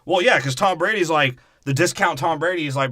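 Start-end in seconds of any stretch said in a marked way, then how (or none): none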